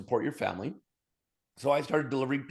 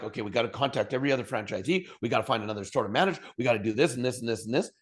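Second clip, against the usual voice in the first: panicked rush; faster than usual. Retold or imitated. Retelling his panic at losing jobs.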